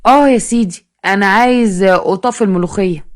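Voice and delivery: ordinary delivery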